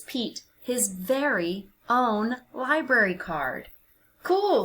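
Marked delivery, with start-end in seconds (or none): 4.2-4.6 high, excited little cat; cheerful young cat. A narrator's reading. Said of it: "says Pete".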